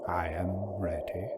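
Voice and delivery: low, hollow whispered reply; muffled hollow whisper. Acted. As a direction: breathe it out hollow and dazed. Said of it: "It sounded muffled and hollow".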